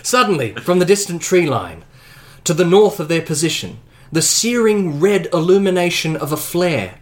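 dramatic game master narration of the flare; story narration. A narrator's reading. Game master describing the flare to the players, suspenseful narration. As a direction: build the scene with suspense.

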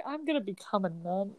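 soft, hushed imitation; all quiet. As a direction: drop your volume for the quoted line. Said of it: "like, all quiet".

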